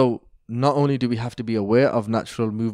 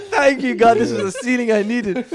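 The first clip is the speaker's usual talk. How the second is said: high, louder, laughing through it; laughing thanks; 9 semitones higher, 4 dB louder than usual. Retold or imitated. Retelling his relief to his friend, laughing high and loud. Laughing at sheikh's welcome answer.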